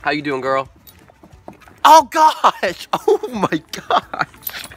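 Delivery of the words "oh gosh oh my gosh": laughing. Real, not acted. Laughing after the dog licks him.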